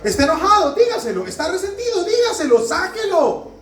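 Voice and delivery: high and loud, pressing each command; urgent preaching. Real, not acted. Preacher urges the congregation, high and loud. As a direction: urge them to let it all out.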